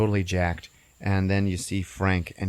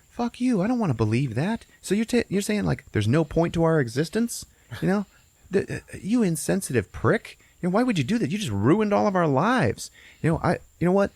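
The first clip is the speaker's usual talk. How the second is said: high, indignant mock outrage; retold indignant protest; 9 semitones higher than usual. Food turning on the bearer of bad news, indignant. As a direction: protest in a raised, affronted pitch. Horrified food turns on Frank.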